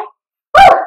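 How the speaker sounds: high, loud cheering whoop; a big woo of encouragement; excited whoop. Real, not acted. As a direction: whoop to fire up the class. Instructor cheering class mid-exercise.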